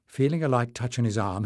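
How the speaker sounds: ordinary delivery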